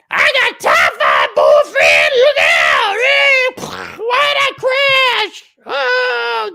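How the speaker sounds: very high, loud screechy caricature; hammed-up wailing pilot in a crash; loudmouth woman pilot. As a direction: shout in a shrieking put-on voice.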